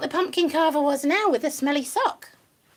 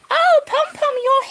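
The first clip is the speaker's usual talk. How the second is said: excited greeting; 9 semitones higher, 6 dB louder than usual. Real, not acted. Greeting other rabbit.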